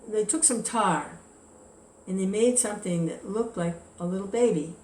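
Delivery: ordinary talk, nothing marked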